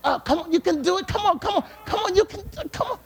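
rapid-fire pep talk, climbing in pitch; exhorter urging on. Acted. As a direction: egg them on like an urgent coach. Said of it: "Exhorter says".

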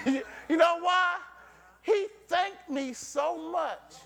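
high, emphatic sermon delivery; rousing preacher in full cry; full-force preaching. Real, not acted. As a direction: preach it hard with ringing repeats.

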